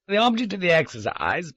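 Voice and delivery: a hint of a smug villain; confident announcement. A narrator's reading. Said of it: "Garrard announced confidently".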